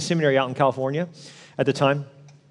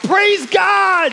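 loud, much higher joyful shout; yelled out; 6 dB louder than usual. Retold or imitated. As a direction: cry it out in relief. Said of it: "just yelled out".

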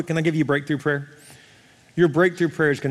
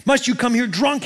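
mock indignant demand, higher pitch; 8 semitones higher than usual. Preacher reads Eli confronting Hannah, high demanding question. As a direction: put it as an accusing question. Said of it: "he demanded".

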